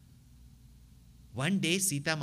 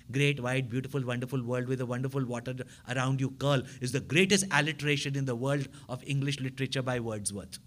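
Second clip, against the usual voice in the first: ordinary delivery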